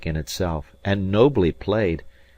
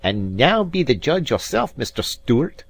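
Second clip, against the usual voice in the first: higher, louder, mocking Highlander; haughty Scots piper, raised and scornful; proud mocking Robin; 7 semitones higher, 4 dB louder than usual. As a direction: praise him with a sneer. Robin challenging Alan.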